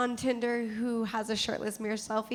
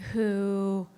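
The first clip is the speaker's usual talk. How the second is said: usual speaking voice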